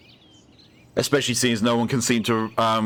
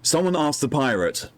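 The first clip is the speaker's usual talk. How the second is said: usual speaking voice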